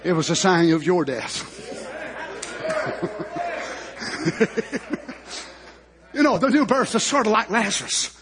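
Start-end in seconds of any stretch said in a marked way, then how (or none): 1.6-5.4 long soft laugh. Real, not acted. Preacher pausing after speaking of the new birth, soft laughing. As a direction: let out a quiet, drawn-out laugh.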